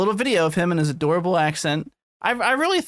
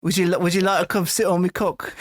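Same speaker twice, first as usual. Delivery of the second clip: hammed-up British proposition; mock British accent. Parodying the actor's bedroom talk, mock British accent. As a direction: ask it in a silly British accent. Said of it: "you're still British, buddy".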